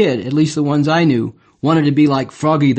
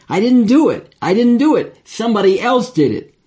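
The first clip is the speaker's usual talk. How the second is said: higher-pitched protest of innocence; insisting; 6 semitones higher than usual. Retold or imitated. Pupil denies the prank to the teacher, insistent protest. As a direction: insist on it, pitch jumping up on each denial. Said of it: "but I kept insisting".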